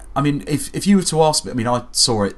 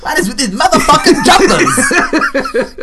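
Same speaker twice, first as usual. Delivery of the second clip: Samuel Jackson; 7 dB louder, slower than usual. Retold or imitated. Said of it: "Samuel Jackson's in there".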